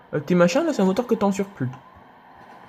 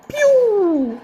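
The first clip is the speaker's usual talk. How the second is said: mock gun sound; 13 semitones higher, 3 dB louder than usual. Imitating gunfire in game.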